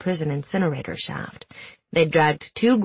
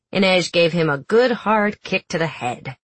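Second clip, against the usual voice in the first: plain reading voice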